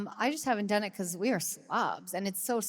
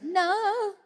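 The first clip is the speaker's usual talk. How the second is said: mock desperate plea; 10 semitones higher, 8 dB louder, slower than usual. Pleading as bus leaves.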